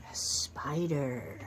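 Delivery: low and slow, like a spooky hint; deep slow spider reveal. Pretend. Reading to a child, revealing the spider in a low slow voice. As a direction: drop the voice down and drag it out.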